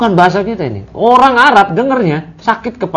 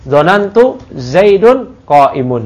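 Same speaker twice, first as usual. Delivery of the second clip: slow, careful recitation of the Arabic; slow model reading; much slower than usual. Shown as a demonstration. Teacher reciting the Arabic example slowly, deliberately. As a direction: read it out slowly for students to copy.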